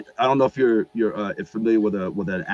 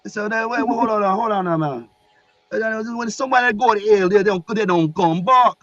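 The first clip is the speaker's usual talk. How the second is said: high, loud Jamaican objection; Jamaican accent; 13 semitones higher, 6 dB louder than usual. Retold or imitated. Archangel objects that nobody returns, high Jamaican accent. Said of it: "he had, like, this Jamaican accent".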